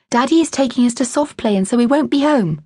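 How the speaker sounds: put-on high, excited child blurting down the phone; a small daughter yelling the news at mum; little girl shouting. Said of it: "Naomi shouted".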